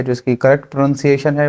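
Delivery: ordinary talk, nothing marked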